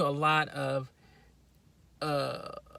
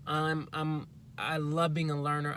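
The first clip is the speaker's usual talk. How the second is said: usual speaking voice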